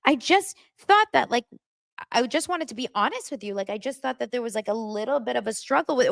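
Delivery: mock a tentative man explaining himself; hesitant fiancé. Retold fiancé timidly explaining himself.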